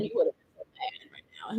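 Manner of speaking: hushed unvoiced aside; real whisper. Real, not acted. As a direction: whisper it like a secret. Whispering about husband.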